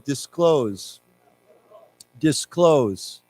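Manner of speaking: "Disclose" said as dis-KLOHZ; "Disclose" alone, ending on a falling tune; repeat-after-me model. Shown as a demonstration. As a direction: model "Disclose" with stress on the second syllable. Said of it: "why don't we say it again".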